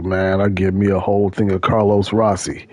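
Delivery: low, a lightly voiced big ex-con buddy; imitating the coworker. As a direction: drop the voice for a jacked prison buddy. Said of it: "You sound like this".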